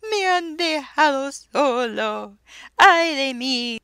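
slow, loud, high wail; crying lament. A narrator's reading. Soso, left behind, wails his lament, slow and high. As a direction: wail it in lonely grief. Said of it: "Y él lloraba".

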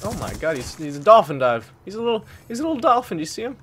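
loud, high and fast; amused excitement. Real, not acted. Gamer laughing at an enemy's dive, quick loud excitement. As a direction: gush about it quickly.

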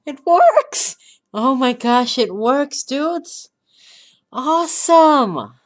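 high, loud joyful shout; excited cheer. Real, not acted. Gamer cheering to his viewers when the run works. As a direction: explode with delight.

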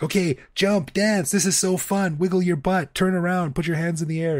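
put-on high, bright cheerleading to a small child; excited cheering. Parent cheering kid dancing at casting, excited high voice.

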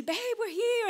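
high, excited call across the summit. Retold or imitated. Husband calling his wife over at the top, excitedly high. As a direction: wave her over happily.

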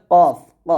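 "কফ, ক" short, loud and heavy from the back of the tongue; thick qaf. Shown as a demonstration. Teacher demonstrating the thick sound, thick qaf. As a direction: punch out a thick, rounded "কফ, ক". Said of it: "পাল্টা আওয়াজ দিয়ে উচ্চারণ করবেন মোটা করে".

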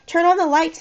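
high, urgent plea; pleading. A narrator's reading. Corey calling out in the blackout, pleading. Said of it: "I heard Corey plead".

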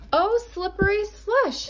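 put-on a girl's dismayed sigh, pitched well up; Little Red's voice. Little Red, slumping in Grandma's chair, lightly voiced girl. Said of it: "she said to Grandma, slumping into a chair".

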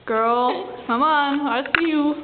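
ordinary delivery

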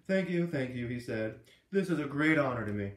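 loud, showy mock-gracious thanks; milk the applause like a star; mock grand acceptance speech; hammed-up thanks. A narrator's reading. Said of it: "his dramatic bow".

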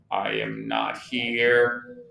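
low, slow and soft reply; unseen sleeper's voice. A narrator's reading. Toad hiding in bed from Frog, low sleepy voice. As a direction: answer low and slow, half asleep. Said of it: "said the voice".

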